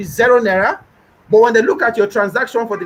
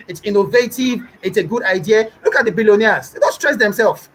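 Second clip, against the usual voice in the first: ordinary delivery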